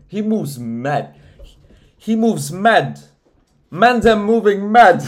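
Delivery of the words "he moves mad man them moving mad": much louder and higher, slow; building incredulous yell; incredulous shout. Real, not acted. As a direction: belt it out in disbelief.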